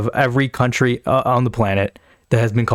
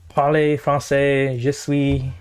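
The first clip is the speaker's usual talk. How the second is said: slow, hammed-up French accent; mock French accent; 3 semitones higher, much slower than usual. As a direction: drawl it like a fake Frenchman.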